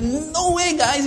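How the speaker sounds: high, loud emphatic protest; Paul pounding the pulpit, high and loud. Retold or imitated. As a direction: pound the point home. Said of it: "He was pounding the pulpit".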